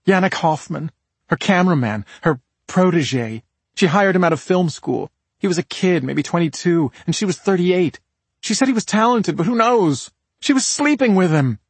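high, pointed character voice; disapproving sister. Acted. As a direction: dish it out with rising indignation. Voices the victim's resentful sister.

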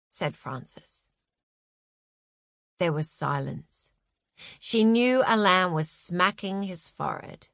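ordinary delivery

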